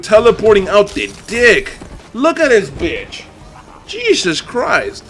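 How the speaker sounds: high-pitched, incredulous griping; exasperated outrage. Real, not acted. Gamer griping at teleporting opponent, high and exasperated.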